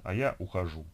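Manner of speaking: usual speaking voice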